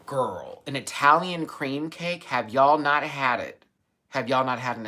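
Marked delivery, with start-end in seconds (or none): none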